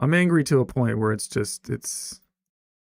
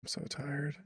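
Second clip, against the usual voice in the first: tired sigh; 4 semitones higher, 13 dB quieter than usual. Real, not acted. Weary confession to chat.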